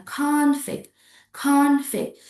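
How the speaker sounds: CONFLICT as a noun, CON lifted high; first-syllable stress. Shown as a demonstration. Teacher repeats the noun, stress on CON. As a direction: raise CON in CONFLICT, drop -flict. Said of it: "stress on the first syllables, means it is a noun".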